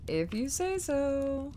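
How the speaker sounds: high, slow put-on voice; odd voice. Streamer answering the game in a put-on voice. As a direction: play along in a strange voice.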